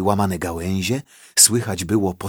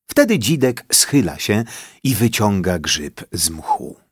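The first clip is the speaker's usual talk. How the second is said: usual speaking voice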